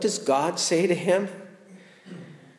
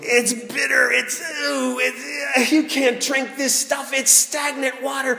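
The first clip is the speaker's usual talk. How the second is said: despairing cry; 7 semitones higher than usual. Acted. Preacher voicing Israelites finding bitter water, despairing. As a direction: cry out that you're doomed.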